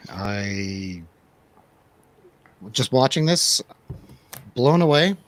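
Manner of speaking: ordinary delivery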